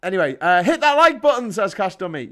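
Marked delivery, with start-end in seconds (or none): 0.6-1.5 loud, high, punchy plug; mock hype-man call; rallying call. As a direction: shout it out to the viewers. Reads viewer's call to action aloud.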